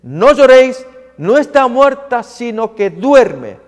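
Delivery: loud, high declaration over mourners; ringing, emphatic announcement; Jesus proclaiming. Acted. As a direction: call out firmly to silence the weeping. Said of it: "Pero él dice".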